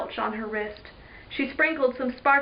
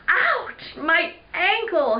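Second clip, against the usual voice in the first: high, pained yelp, then slower; groaned in pain; 7 semitones higher, much slower than usual. Acted. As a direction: yelp and groan over a hurt ankle. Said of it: "he groaned".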